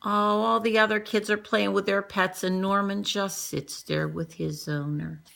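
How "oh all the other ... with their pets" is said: plain reading voice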